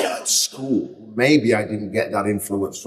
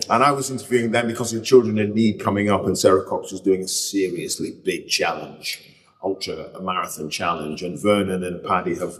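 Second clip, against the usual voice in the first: ordinary talk, nothing marked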